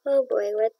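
usual speaking voice

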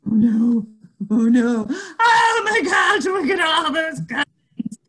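panicked. Acted. Panic at cousins arriving.

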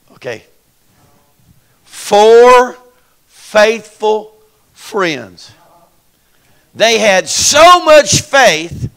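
slow, loud, punched words; emphatic preaching. Real, not acted. Preacher to congregation on four friends, slow loud preaching. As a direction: hammer each word home.

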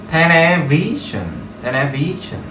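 television said slowly, each syllable clear; slow model word. Shown as a demonstration. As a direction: draw out television for students to copy. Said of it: "Tiếp theo là television".

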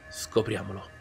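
ordinary delivery

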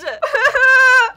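high, loud shrieking laugh; screaming laugh. Real, not acted. Just lost the round, a loud high laugh. After losing.

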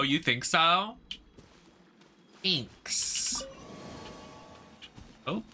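ordinary talk

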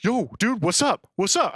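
defensive street challenge; confrontational. Retold or imitated. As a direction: push back at the guy who grabbed you. Confronted stranger pushing back.

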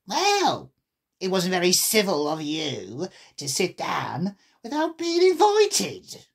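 put-on animal voice, climbing high at the end; huffy storybook creature; March Hare. A narrator's reading. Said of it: "said the March Hare".

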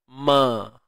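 normal talking voice

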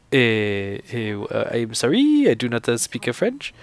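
halting English with a put-on French accent; imitated French speaker. French man apologizes to TV reporter, hesitant mock French accent. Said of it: "then he started speaking French".

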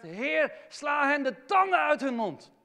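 loud, high cry to the Lord; David crying out. Retold or imitated. David crying out against his enemies, loud and high. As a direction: hurl it at heaven in fury. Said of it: "een David die zegt".

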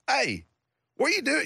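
pry like a dad who won't leave you be; imitating dad. Dad poking at his moping son, high nosy mock voice.

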